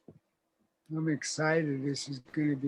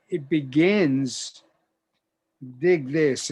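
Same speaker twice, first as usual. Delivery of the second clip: ordinary talk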